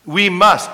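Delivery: "must" punched loud; preacher hammering "must" to the congregation; stress on must. Shown as a demonstration. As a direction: land hard on "must". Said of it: "can't emphasize that word enough".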